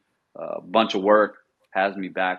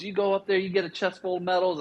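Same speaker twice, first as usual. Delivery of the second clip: mock cheerful monitor; 11 semitones higher, faster than usual. Monitor's cheerful reply retold.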